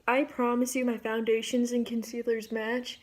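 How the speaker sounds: normal talking voice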